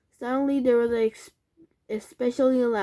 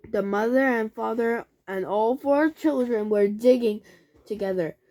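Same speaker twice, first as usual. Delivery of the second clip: usual speaking voice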